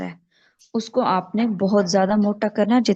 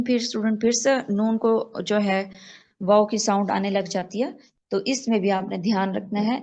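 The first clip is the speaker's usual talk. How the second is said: ordinary talk, nothing marked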